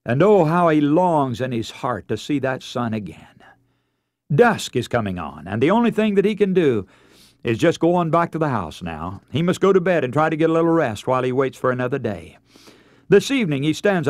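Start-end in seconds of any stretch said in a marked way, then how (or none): none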